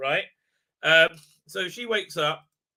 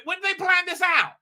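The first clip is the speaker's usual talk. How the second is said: high-pitched disbelieving question; incredulous; 9 semitones higher than usual. Real, not acted. Reviewer questioning when the characters planned it, high incredulity. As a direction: push it high in exasperated disbelief.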